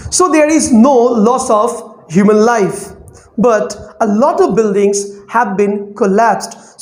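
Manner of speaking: slow, measured news reading in short phrases; scanning with breath breaks. Shown as a demonstration. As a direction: pause for breath at each phrase, keeping the line going. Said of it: "I'm taking breath".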